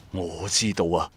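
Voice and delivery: ordinary talk, nothing marked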